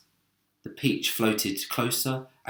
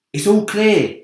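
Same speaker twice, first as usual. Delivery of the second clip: loud high call; 10 semitones higher, 11 dB louder than usual. Acted. James calling to the others below, loud high call. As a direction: call it down the tunnel. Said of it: "he called".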